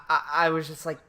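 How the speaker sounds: normal talking voice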